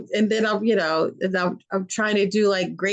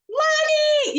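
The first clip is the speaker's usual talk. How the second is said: put-on child calling out. Child calling a grown-up by name.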